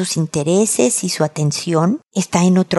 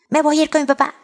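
put-on higher-pitched kid's threat; threatening son; 7 semitones higher, much faster than usual. Son threatening his mother to go to dad, defiant. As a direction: play a child throwing out a threat.

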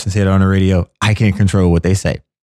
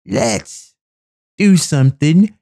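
high, slow, sweet mocking voice; 6 semitones higher, much slower than usual. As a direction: coo it like a syrupy love song. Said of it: "I don't need anything about".

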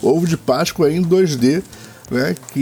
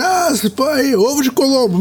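mocking voice; 11 semitones higher than usual. Mocking what people say.

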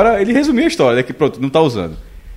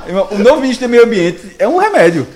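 1 laughing; 7 semitones higher, 3 dB louder than usual. Real, not acted. Joke about the new minister.

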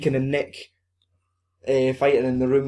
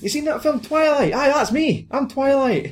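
high put-on braggart voice; excited high-voiced show-off; high-pitched boasting; 12 semitones higher than usual. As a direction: brag to clubbers, pitched way up. Acting out Nick boasting in clubs.

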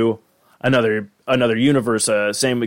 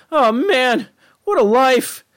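put-on high-pitched kid sidekick lament; Morty; 12 semitones higher, slower than usual. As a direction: lament it in a much higher cartoon voice. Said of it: "Morty's just like".

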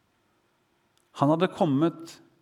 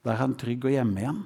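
ordinary talk

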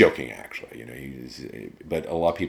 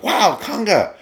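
excited cheer; 9 dB louder, slower than usual. Retold or imitated. Onlooker cheering the flogging line.

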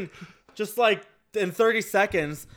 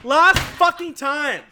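frustrated outburst; 5 semitones higher, 5 dB louder, slower than usual. Real, not acted.

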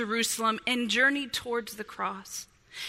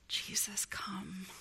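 very soft, breath-only plea; whispered prayer; 6 semitones lower, 13 dB quieter, slower than usual. Acted. Wordless preacher turns to prayer.

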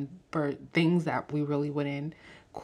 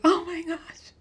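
much higher, louder starstruck squeal; excited gasp; 13 semitones higher, 5 dB louder, slower than usual. Retold or imitated. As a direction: reenact a gasp in the cinema. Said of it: "who vocally reacts in the theater".

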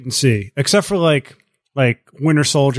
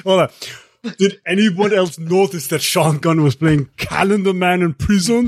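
Arnold Schwarzenegger; 4 semitones higher than usual. Acted. Cohost retrying a requested impression for friends, Austrian accent. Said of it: "[name], do the Schwarzenegger".

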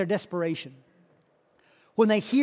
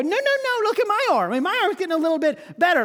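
much higher, louder excited protest; amazed insisting; 8 semitones higher, 3 dB louder, faster than usual. Retold or imitated. Another leper insists, amazed.

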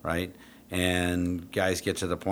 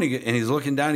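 ordinary delivery

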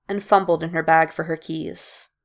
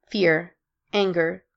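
ordinary delivery, nothing marked

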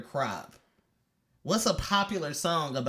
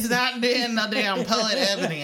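louder, higher protest; indignant protest; 7 semitones higher, 6 dB louder than usual. Real, not acted. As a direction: push back, offended. Protesting being called poet.

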